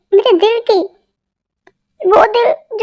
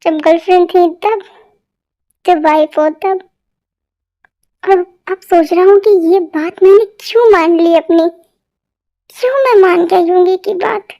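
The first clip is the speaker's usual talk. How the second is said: ordinary talk, nothing marked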